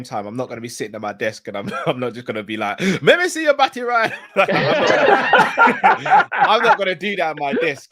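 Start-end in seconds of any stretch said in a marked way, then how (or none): 3.0-4.1 high, loud playful patois shout; mock loud slang. 4.2-7.9 laughing through the words; high, giggly, slow at first. Real, not acted.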